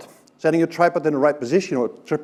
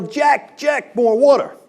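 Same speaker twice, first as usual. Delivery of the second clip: high, loud call for more water; yelling again; 9 semitones higher, 4 dB louder, slower than usual. Retold or imitated. Calling across the garden to Jack again, loud yell. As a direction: shout louder for Jack. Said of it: "And I yell".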